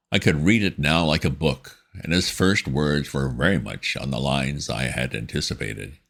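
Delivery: plain reading voice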